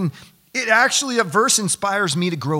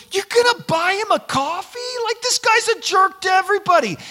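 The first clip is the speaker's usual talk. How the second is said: high, mock-indignant woman's voice, much higher and louder; incredulous lady; 5 dB louder than usual. Lady in crowd scolding Josh, high shocked voice. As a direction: squeal the lady's disbelief. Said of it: "this lady looks over".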